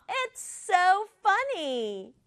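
ordinary talk, nothing marked

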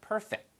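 "perfect" with a real f, no added vowel; English f sound. Shown as a demonstration. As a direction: model "perfect" softly, final t barely released. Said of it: "위치아가 아랫입술에 가서 붙는 거예요".

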